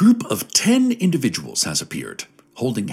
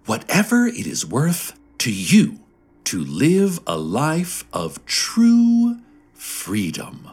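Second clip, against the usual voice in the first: serene; 7 semitones higher, slower than usual. A narrator's reading. Serene group answers about the dues.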